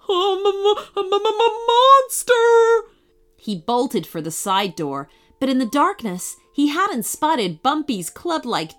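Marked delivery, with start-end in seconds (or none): 0.1-2.9 high, loud stuttering panic; terrified stammer. A narrator's reading. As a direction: stammer it out in terror. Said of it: "screamed in terror".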